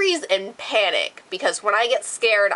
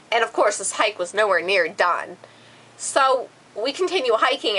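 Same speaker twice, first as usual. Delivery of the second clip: ordinary talk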